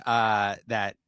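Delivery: usual speaking voice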